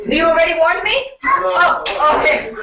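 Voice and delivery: loud, quick, startled question; surprised. Real, not acted. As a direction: caught off guard by the warning. Surprised by the time warning.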